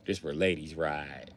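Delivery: put-on low, soft, slow prim old lady; haughty nana. Nana answering from the back seat, low and prim. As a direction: say it like a proper lady looking down.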